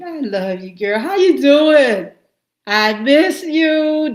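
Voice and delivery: high, loud, drawn-out hello; delighted, slow and loud; excited greeting. Real, not acted. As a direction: gush over a friend you missed. Greeting a friend on stream.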